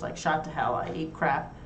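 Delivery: usual speaking voice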